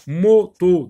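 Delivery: MOTO said loud and high, split MO - TO; découpage en syllabes. Shown as a demonstration. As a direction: break MOTO into two strong, separate syllables. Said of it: "se compose de deux syllabes". (« moto » bien fort, syllabe par syllabe)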